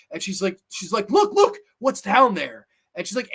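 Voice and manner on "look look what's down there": higher, louder insistent order; forceful demand. Acted. Angela forcing Allie to look down, forceful demand. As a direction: press the question hard.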